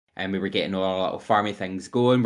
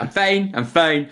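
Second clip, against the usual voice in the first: higher-pitched, drawn-out mock insistence; insistent reassurance; 7 semitones higher, 4 dB louder than usual. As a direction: imitate someone insisting all is well. Retelling a friend's flustered denial.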